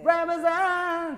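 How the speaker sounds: "Ramazan" sung high, loud and drawn out as garbled lyric; mumbled lyric. Shown as a demonstration. Teacher mocking bands that slur lyrics: drawn-out garble. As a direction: belt "Ramazan" slowly like a misheard chorus. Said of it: "porque você ouve assim".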